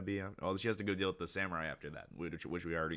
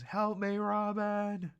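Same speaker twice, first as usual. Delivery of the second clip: very high, drawn-out mock cry for help; desperate scream; 4 dB louder, much slower than usual. Sanji begs Robin to rescue him, desperate high scream. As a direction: wail it out in falsetto. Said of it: "desperately screams".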